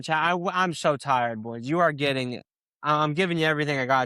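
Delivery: normal talking voice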